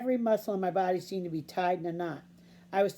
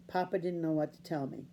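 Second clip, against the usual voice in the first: ordinary delivery, nothing marked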